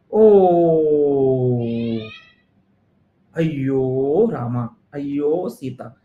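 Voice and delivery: drawn-out slow wail; mock despair. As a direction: groan it like all is lost. Student despairs after realising mistake.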